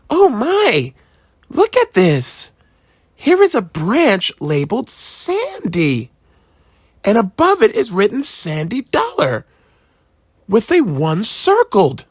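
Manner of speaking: high, astonished mom voice; a mother surprised by the page; amazed mother. A narrator's reading. Mother reading the family tree, amazed voice.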